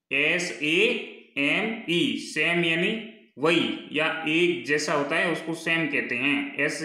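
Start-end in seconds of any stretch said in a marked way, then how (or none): none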